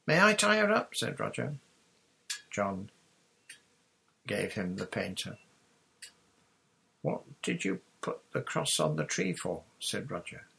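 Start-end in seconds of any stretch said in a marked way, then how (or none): 0.1-0.9 put-on a small boy's voice, pitched well higher; eager little boy asking to help; young boy Roger. Little brother begs the captain to moor the boat, high eager boy voice. 7.0-9.6 lightly voiced small boy, pitched higher; a curious little boy's question; young boy Roger. Little brother asks John about the cross, lightly voiced boy.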